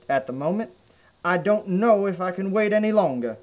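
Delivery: usual speaking voice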